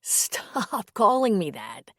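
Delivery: slow, laughing protest. Acted. Priest laughing as woman calls him father. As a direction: chuckle the words out slowly.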